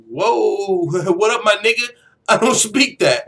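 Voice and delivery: loud, high mock alarm with a laugh; startled protest. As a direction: blurt it out in playful shock. Acts out his startled reaction.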